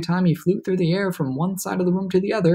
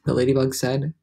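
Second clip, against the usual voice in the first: ordinary delivery, nothing marked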